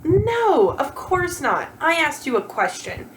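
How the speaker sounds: usual speaking voice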